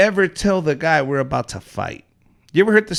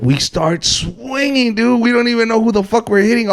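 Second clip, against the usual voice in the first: normal talking voice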